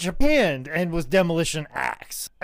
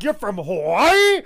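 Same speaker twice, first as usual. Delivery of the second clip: loud, drawn-out disbelief; astounded Vince; 5 dB louder, slower than usual. Acted. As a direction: stretch it out as an outraged question. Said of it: "Vince's like".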